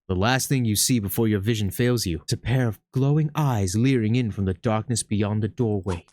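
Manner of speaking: ordinary talk, nothing marked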